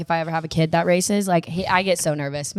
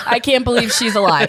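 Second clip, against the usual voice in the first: laughing disbelief; 4 semitones higher, 4 dB louder than usual. Retold or imitated. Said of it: "It was like a".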